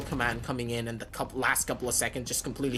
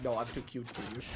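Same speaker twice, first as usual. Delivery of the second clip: sassy brush-off; 4 semitones lower, 10 dB quieter than usual. Retold or imitated. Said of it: "Kawhi's like".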